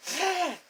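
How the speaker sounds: high imitated gasp; mock gasp. Woman stops dressing and stares at him, imitated high gasp. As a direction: gasp high, as she did. Imitates her startled reaction.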